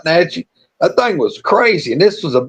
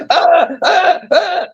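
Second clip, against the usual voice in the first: mock screaming; 11 semitones higher, much slower than usual. Storyteller imitating his coworker bitten by a cat, high mock screams. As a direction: imitate a man yelping in short, high bursts. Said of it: "this old boy goes to screaming".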